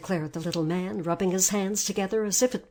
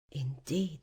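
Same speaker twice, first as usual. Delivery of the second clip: ordinary delivery, nothing marked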